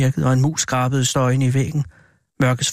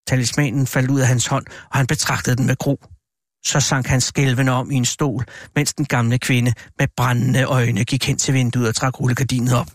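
ordinary delivery

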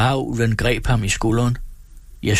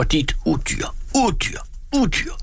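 angry cursing; 7 semitones higher than usual. A narrator's reading. Desperate peasant cursing his captor, angry cursing. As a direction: fling the insult higher each time. Peasant curses the forester as a beast.

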